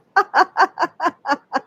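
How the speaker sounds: high peal of laughter. Shown as a demonstration. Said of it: "laughing as you go".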